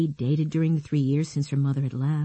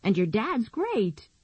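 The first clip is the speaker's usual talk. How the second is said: put-on girl's voice; 6 semitones higher, slower than usual. Nicky voiced by narrator.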